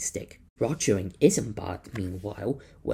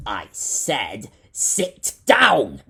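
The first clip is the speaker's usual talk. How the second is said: rising to a shout; 10 dB louder, much slower than usual. A narrator's reading. Creature shouting at the captain, building to loud. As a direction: let it swell into a roar on sit down. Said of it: "Slowly raising its voice, he shouted".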